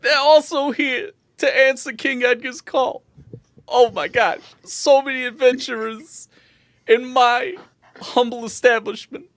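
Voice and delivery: high, gushing barkeep voice; overjoyed bartender. Acted. Bartender amazed by adventurers in a humble establishment, high and overjoyed. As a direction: marvel at the company in a high voice.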